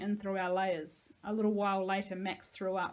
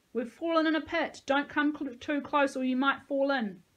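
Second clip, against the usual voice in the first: raised, high warning shout; 6 semitones higher, 6 dB louder than usual. A narrator's reading. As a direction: call up loudly to someone overhead. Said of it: "Nico shouted up".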